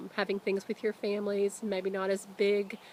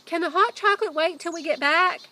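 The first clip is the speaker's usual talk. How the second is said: shouting to dad; 9 semitones higher, 9 dB louder than usual. Acted. Jack still shouting to dad.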